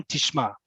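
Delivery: "Tishma" landed firmly, going down at the end; falling ending. Shown as a demonstration. Teacher models telling a man to pay attention, "Tishma" with a falling end. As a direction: let "Tishma" drop on its last syllable, like a statement. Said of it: "make sure it goes down at the end".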